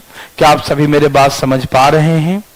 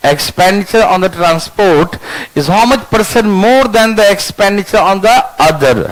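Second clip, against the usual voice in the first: slow dictation; much slower than usual. Shown as a demonstration. Teacher dictating question slowly.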